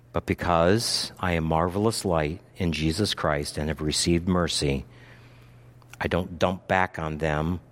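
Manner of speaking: quiet reflective preaching. Acted.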